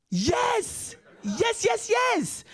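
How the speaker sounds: very high squealing yes; mock shrieking mother. The mother hears the salary, hammed-up high shriek. Mother's excited reply voiced.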